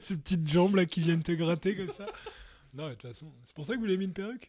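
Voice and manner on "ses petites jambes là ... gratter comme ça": high, laughing through the words; giggling while talking. Real, not acted. Laughing at the Roomba joke.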